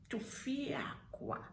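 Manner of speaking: hushed, breath-heavy Tufiakwa, drawn out; demonstrated exclamation. Shown as a demonstration. Showing viewers Tufiakwa, slow and whispered. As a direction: whisper Tufiakwa slowly in disgust. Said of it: "Look at me".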